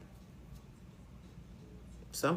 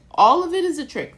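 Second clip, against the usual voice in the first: much higher, louder declaration; emphatic exclaim; 11 semitones higher, 11 dB louder than usual. Real, not acted. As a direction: shout the truth out. Emphatic revelation.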